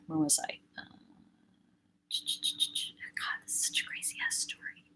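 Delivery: breathy whisper to herself; quick whispered aside, as if only to yourself. Real, not acted. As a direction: murmur it under your breath. Aside to herself mid-story.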